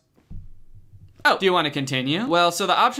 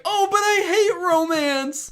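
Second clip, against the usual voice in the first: very high, drawn-out mock complaint; mock whiny writer; 12 semitones higher, slower than usual. Writer stuck in a romance scene, high mock whine. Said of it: "then was like".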